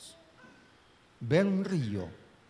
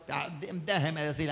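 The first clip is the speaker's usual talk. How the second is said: ordinary talk, nothing marked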